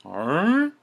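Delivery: high, loud baffled huh; a stunned, loud question; confused huh. Retold or imitated. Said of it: "And it was just like".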